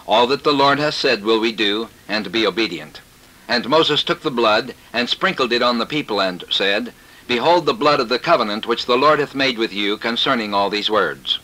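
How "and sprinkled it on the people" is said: ordinary talk, nothing marked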